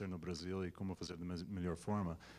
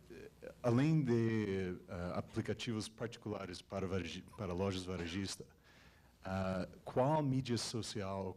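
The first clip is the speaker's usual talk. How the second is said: Portuguese with an English accent; American speaker in careful learner Portuguese. Real, not acted. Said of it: "Desculpa o meu português".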